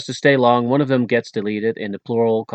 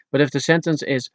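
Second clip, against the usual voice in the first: usual speaking voice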